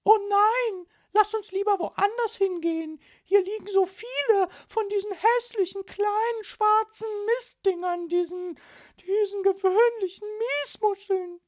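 very high, loud, shrill-free squeal of disgust; disgusted mother. Acted. Mother calling to her family on the beach, loud and high with disgust. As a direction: shriek it out like a fussy lady. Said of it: "rief Frau Linkmann".

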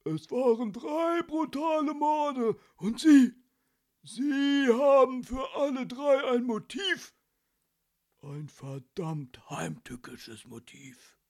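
loud, high, slow accusation, dropping at the end; rage, then sink to a sinister close; ranting. A narrator's reading. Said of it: "wetterte weiter".

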